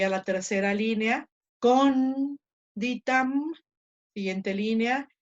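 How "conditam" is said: "conditam" very slow, with CON high and long; stressed syllable drawn out. Shown as a demonstration. Teacher reads the third line, "conditam" stretched on CON. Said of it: "acentuándola, y entonces leo".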